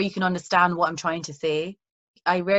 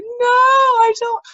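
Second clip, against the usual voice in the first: high, loud, slow sobbing no; crying child's refusal; 8 dB louder, much slower than usual. Retold or imitated. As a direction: drag it out like a kid crying at her dad. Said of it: "I was like".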